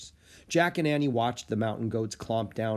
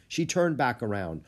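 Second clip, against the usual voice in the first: usual speaking voice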